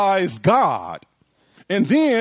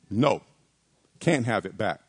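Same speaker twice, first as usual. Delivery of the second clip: lower, firm refusal in a father's voice; rejecting father; 8 semitones lower than usual. Acted. Preacher as the father refusing his son, low rejecting voice. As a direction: turn the plea down in a low register. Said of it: "his father would say".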